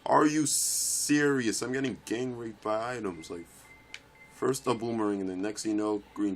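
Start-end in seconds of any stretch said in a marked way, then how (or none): 0.0-1.6 slow, loud, raised pitch; exasperated outburst. Real, not acted. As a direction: stretch it out in loud disbelief. Hit by items in game.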